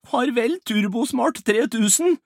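loud, high-pitched farewell cry; dramatic lament. Acted. Rolf mourns his lost riches, wailing loudly.

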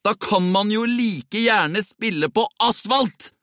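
high, slow, emphatic outburst; indignant yelling. A narrator's reading. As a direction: shout the protest out word by word. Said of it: "hadde P skreket". (opprørt og høylytt protest)

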